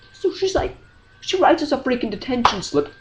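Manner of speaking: ordinary talk, nothing marked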